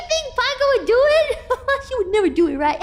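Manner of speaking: high voice bubbling with laughter; laughing, squeaky and amused; giggling question. Real, not acted. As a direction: ask it through high giggles. Giggling over friend trying it.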